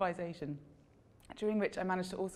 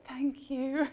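higher, overly effusive thank you; gushing thanks; 7 semitones higher than usual. Retold or imitated. To the boom operator after the hug, gushing thanks. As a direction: thank him far too warmly. Hugging boom guy from behind.

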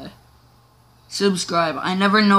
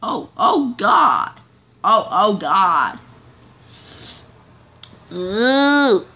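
panicked oh god; 6 dB louder, much slower than usual. Real, not acted. Crash panic in game.

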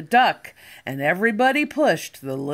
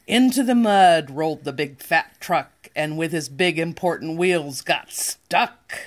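plain reading voice

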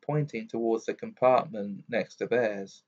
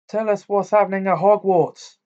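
loud, high call; bellow; 8 semitones higher, 7 dB louder than usual. A narrator's reading. Boy calling from a departing train window, loud bellow. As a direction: call out urgently over distance. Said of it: "bellowed Fred out of the window".

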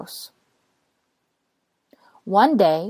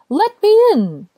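high, louder plea; pleading rain; 12 semitones higher, 6 dB louder than usual. Acted. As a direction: beg to be let in.

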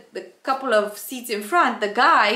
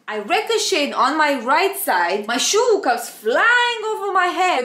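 ordinary talk

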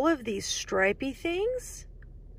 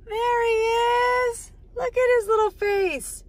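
higher and louder, thrilled discovery; excited there-he-is; delighted; 10 semitones higher, 6 dB louder than usual. Acted. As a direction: show the kids your delight. Finding the hidden frog.

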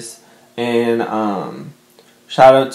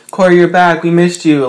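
mock-excited cheer, higher and louder; excited fans; 6 semitones higher, 5 dB louder than usual. Viewers cheering Corey's return, excitedly voiced. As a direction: play the fans welcoming him back.

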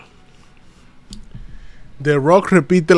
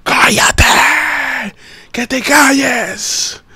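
higher, slow taunting hush; mock Seth Rollins; 7 semitones higher, much slower than usual. Streamer imitates Seth shushing The Rock, slow and higher. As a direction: tell him to shut up in a drawn-out higher voice. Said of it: "Seth Rollins le dijo".

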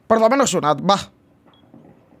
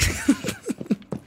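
high breathy laugh; 9 dB quieter than usual. Real, not acted. Laughs at failed play.

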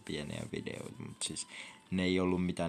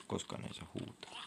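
hushed whisper, softer; 2 semitones higher, 8 dB quieter than usual. Shown as a demonstration. Gamer reminding himself not to shout, whispered. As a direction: whisper it so you don't break the rule. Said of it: "Ei huudeta".

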